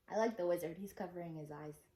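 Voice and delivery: ordinary delivery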